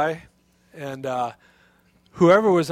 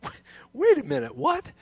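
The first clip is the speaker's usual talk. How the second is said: astonished crowd; 3 semitones higher, 5 dB louder than usual. Retold or imitated. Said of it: "And they were going".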